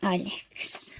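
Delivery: breathless whisper of his name; a hushed, stunned whisper. Acted. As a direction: barely voice it in shock. Stunned wife under breath.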